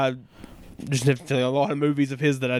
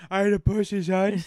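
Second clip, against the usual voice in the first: mock hard-man reply through laughter; laughing while playing the bruiser; tough guy voice, laughing; 6 semitones higher than usual. Answering in the Scum voice.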